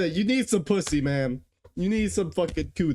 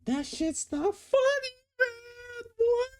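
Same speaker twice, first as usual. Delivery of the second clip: disbelief; slower than usual. Real, not acted. Reacting to Minotaur video.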